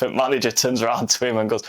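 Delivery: ordinary talk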